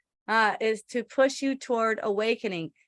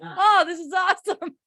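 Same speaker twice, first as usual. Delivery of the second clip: sunny, overdone "oh, this is awesome"; mock cheerful; 5 semitones higher, 4 dB louder than usual. Lightly acting out a rewrite of her own gripe, mock cheerful. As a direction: flip the gripe into fake delight. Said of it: "And I'll go".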